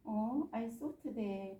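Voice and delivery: ordinary talk, nothing marked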